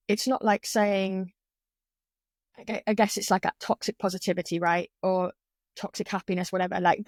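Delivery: usual speaking voice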